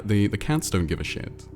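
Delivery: ordinary delivery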